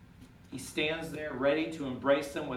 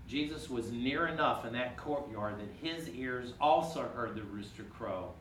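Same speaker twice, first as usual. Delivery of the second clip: ordinary talk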